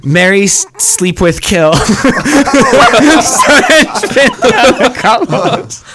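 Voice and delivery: cracking up at own joke, pitch climbing high; high, laugh-filled delivery. Real, not acted. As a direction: get the words out through giggles. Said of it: "I'm laughing because I just thought of a".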